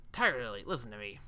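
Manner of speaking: usual speaking voice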